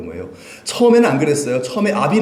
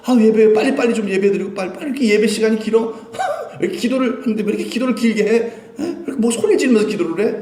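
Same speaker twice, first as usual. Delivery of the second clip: higher-pitched mock grumbling, hurried in places; impatient complaining; 6 semitones higher, faster than usual. Churchgoer griping that the service and prayers drag on, impatient. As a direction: play a worshipper fed up with long services.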